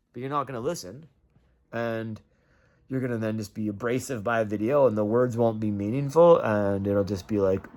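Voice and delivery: ordinary delivery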